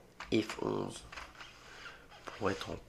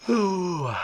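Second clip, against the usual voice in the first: mock delighted oh; 9 semitones higher, 12 dB louder than usual. Real, not acted. Reacting to own plug.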